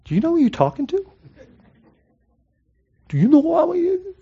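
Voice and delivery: fast, high, offended retort; indignant rebuke. Pretend. Jesus imagined rebuking Martha, indignant and fast. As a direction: snap back like someone insulted. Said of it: "He could easily go".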